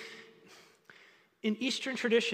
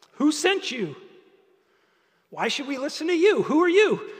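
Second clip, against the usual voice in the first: higher and louder, a suspicious crowd demanding answers; doubting crowd; 8 semitones higher, 7 dB louder than usual. Acted. Moses imagines the people challenging him, suspicious. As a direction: challenge him like a skeptical mob.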